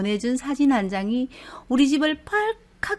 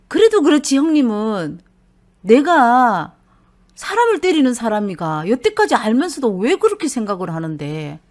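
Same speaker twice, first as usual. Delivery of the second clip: louder, hurt and indignant husband; aggrieved husband; 7 dB louder than usual. Retold or imitated. Husband complaining about his brother-in-law, loud and aggrieved. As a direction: protest loudly that he is wronged.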